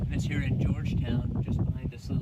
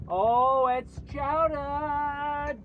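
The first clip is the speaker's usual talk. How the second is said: drawn-out Boston chowdah; Boston accent; 10 semitones higher, 4 dB louder, much slower than usual. Pretend. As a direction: stretch it out, high and loud, Boston style. Mock Boston excitement at chowder.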